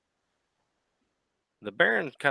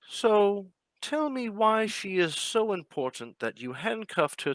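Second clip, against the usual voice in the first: mocking villain; 11 semitones higher, slower than usual. Villain questions commissioner.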